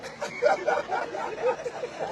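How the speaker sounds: quiet breathy laugh. Real, not acted. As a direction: laugh softly to yourself. Laughing after his own jibe.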